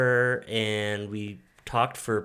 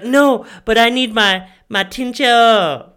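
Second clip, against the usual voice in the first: high, slow, whiny mock voice; mock her whining for tincture; whiny sick wife; 10 semitones higher, 12 dB louder than usual. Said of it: "She's like".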